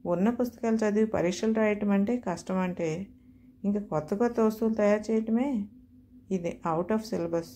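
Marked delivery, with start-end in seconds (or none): none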